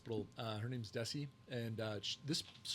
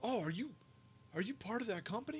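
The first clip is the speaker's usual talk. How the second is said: high, excited fan voice; eager young fan; 11 semitones higher than usual. Retold or imitated. Customer in the humidor asking if he's the owner, high and eager. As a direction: ask it wide-eyed, pitched well up.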